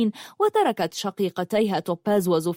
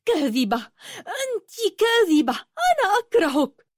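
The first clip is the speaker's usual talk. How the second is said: much higher crying scream; 12 semitones higher than usual. Acted. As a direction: shriek the accusation through tears. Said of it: "صرخت ياقوت".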